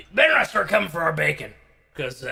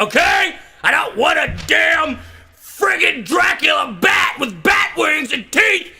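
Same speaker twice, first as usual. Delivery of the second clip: high, loud comic outrage; hammed-up indignant tirade; worked-up rant; 7 semitones higher, 3 dB louder than usual. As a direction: rant at the top of your voice. Mock rant against Dracula.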